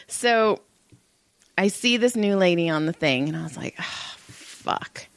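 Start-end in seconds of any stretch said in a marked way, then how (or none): 3.8-5.1 sighed, whispery curse under the breath; soft, airy groan of dismay. Retold or imitated. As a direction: breathe it out voicelessly, full of dread. Dreading a new client, retold.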